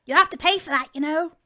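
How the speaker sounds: officious mock reprimand; steward quote. Co-host acting a fussy steward.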